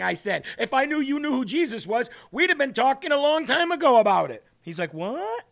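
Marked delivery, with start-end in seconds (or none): 0.6-4.4 high, eager retelling, growing louder; retold excitement. Retelling his eager reply to nephew. 4.9-5.5 high-pitched, rising mock "what"; retold startled question; imitated surprise. Imitating nephew's puzzled reaction.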